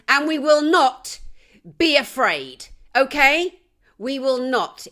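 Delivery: loud, defiant vow; shouted battle-cry defiance; rallying shout. Real, not acted. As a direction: rouse the crowd with it.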